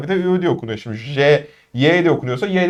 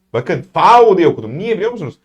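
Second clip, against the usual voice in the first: usual speaking voice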